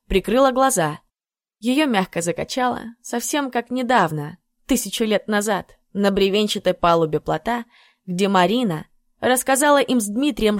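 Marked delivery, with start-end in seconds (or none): none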